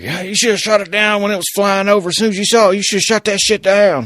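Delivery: high, loud caricature of angry critics; mock outraged public. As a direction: yell it like an indignant armchair critic. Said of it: "the whole pushback of, like".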